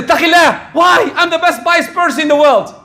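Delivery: indignant shout. Retold or imitated. To the one giving advice, an indignant high shout. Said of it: "if someone tells you".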